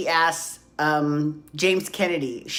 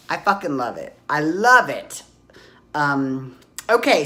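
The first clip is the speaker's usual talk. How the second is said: ordinary talk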